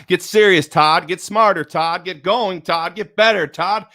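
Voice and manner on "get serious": normal talking voice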